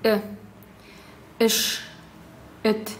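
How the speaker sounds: short, curt vowel in «Э», «эш», «эт»; teacher demonstrating a short э in «эт»; short Tatar э. Shown as a demonstration. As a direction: keep the э brief, as in Tatar «эш». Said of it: "коротко".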